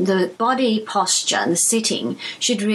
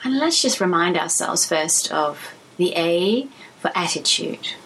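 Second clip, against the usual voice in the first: calm, even voice with long pauses; unhurried phrases, each left to settle; guided meditation. Acted. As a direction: guide them gently through body and breath.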